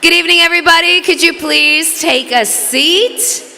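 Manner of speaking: loud, high call over the crowd; yelling to the room. Real, not acted. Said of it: "we're supposed to yell".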